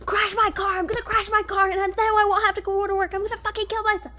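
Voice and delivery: high, frantic rant that builds and speeds up; yelling to myself. Retold or imitated. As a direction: rant hysterically at yourself, racing by the end. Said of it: "I yelling to myself".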